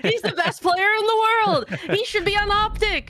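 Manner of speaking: high-pitched, incredulous hype impression; Spaceman. Co-host guessing his colleague's answer, in an excitable high-pitched impression. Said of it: "That's my Spaceman impression".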